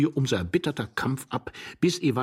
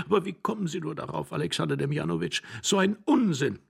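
lightly voiced higher young woman; young wife, dismissive; 7 semitones higher than usual. A narrator's reading. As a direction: brush off the husband's nonsense. Young wife scolds old husband.

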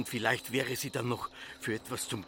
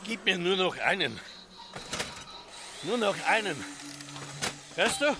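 high pleading; 8 semitones higher than usual. Acted. Father pleads for one more.

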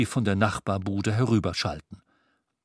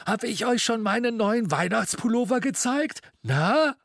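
much higher, eager show-off voice; proud old Scrooge; 10 semitones higher than usual. A narrator's reading. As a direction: fling open the coat and show off. Scrooge showing off his sweater.

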